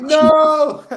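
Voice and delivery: high, loud shout of no; Jacob's yell. Retold or imitated. Jacob hearing his brother cry, loud high yell. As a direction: cry out in alarm. Jacob reacts, voiced comically.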